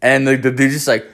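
ordinary delivery

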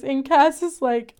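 usual speaking voice

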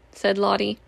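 ordinary delivery, nothing marked

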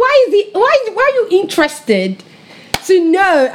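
why would you even ask that; baffled high-pitched why; incredulous surprise. Retold or imitated. Asked about her future partner.

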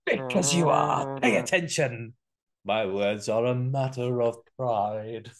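lightly voiced Scar, sung-spoken; menacing lion half-sung; Jeremy Irons voice. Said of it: "Jeremy Irons as, uh, Scar".

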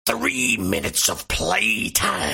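very low, slow wrestler growl; drawn-out, menacing wrestler taunt; Randy Savage. Retold or imitated. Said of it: "exactly the way he holds up the".

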